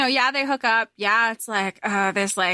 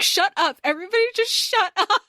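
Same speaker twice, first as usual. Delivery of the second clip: exasperated shout; 10 semitones higher than usual. Retold or imitated. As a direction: yell at everyone to shut up. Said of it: "She's like".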